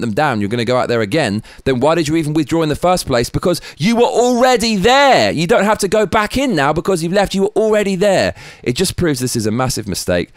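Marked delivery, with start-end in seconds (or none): 3.3-8.3 nearly shouting the obvious; exasperated outburst. Real, not acted. Angry rant peak.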